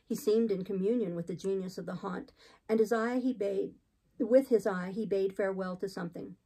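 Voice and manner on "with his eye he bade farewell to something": ordinary delivery, nothing marked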